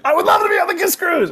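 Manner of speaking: very high, rushed gush of mock excitement; thrilled acceptance. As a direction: squeal a yes at racing speed. Retold thrilled yes to cruise invitation.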